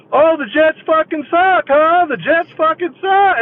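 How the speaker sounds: high-pitched gloating yell; going nuts. Retold or imitated. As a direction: throw the insult back in wild triumph. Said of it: "I just go nuts".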